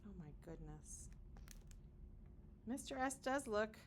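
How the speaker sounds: ordinary delivery, nothing marked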